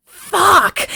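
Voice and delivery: high, punchy mock-Roy curse; blurted realization. Host imitating Roy as he comes to a realization, high blurted curse. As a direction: blurt it as a sudden dawning realization. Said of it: "he just goes".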